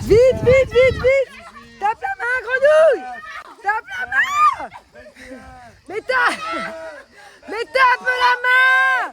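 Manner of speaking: loud, high urgent yelling; shouting encouragement. Real, not acted. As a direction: yell at her to hurry. Cheering on the race.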